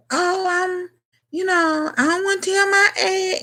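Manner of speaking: high, drawn-out coy voice; mock coy woman. Host mimicking women hiding their age, high coy voice. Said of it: "that's how women are".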